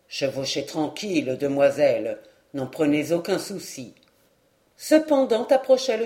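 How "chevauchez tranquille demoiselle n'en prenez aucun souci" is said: a small knight keeping her calm, low; the dwarf calm. Acted. Dwarf reassures again.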